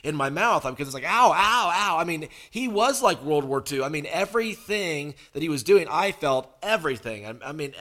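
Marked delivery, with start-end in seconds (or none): 1.0-2.0 sharp loud yelps of pain, retold; mock pained yelps. Preacher reliving the dentist's needle, yelping in pain. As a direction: cry out ow as the needle goes in.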